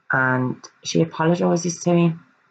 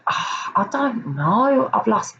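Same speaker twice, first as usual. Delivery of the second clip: higher, airy mock-casual voice; flippant mock shrug; 6 semitones higher, slower than usual. Imitating Lewis's flippant tone on the police call. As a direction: toss it off as if it were nothing. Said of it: "how flippant this conversation sounded".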